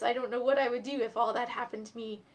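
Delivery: ordinary delivery, nothing marked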